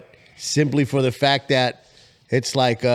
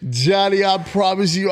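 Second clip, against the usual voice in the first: ordinary talk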